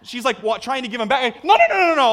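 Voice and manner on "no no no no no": high, loud volley of refusals; hammed-up retold protest; frantic refusal. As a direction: wave it off at full voice. Acted refusal as tickets pushed back.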